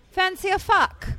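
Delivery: mock Irish pickup line, high; Irish accent. Friend retelling Colin Farrell's line, in a light Irish accent. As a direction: toss it off in an Irish lilt. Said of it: "try it with an accent".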